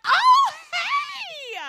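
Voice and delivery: high, laughing shout of hello; cheery greeting. Retold or imitated. Mom greeting someone she knows, high laughing shout. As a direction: call out gleefully to a friend. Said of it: "I'm like".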